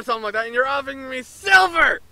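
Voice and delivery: excited outburst. Real, not acted. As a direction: call it out loudly, half joking. Player mocks game inconsistency.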